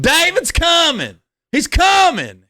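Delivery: high alarmed yell, held long; yelled warning. Retold or imitated. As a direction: call out the warning down the street. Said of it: "three people yelled".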